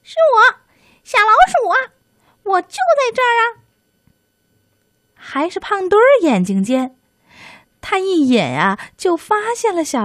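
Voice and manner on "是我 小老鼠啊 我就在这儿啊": very high, loud squeaky call; loud little mouse. Acted. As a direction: shout up high like a tiny mouse. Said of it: "小老鼠大声说".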